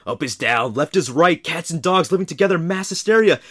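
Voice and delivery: rising hysterical meltdown; panicked rant. Retold or imitated. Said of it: "I can imagine him going like".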